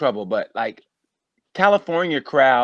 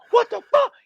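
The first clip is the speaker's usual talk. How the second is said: leaps way up in pitch, louder; disbelieving shout; 5 dB louder than usual. Retold or imitated. Dispatcher recognizes him on 911, shocked high yelp.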